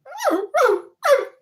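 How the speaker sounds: high, loud yip of a dog that knows he shouldn't bark; a muffled guilty little bark; sharp high dog yelp held back; stifled dog bark. Pretend. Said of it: "That's my impression of a dog".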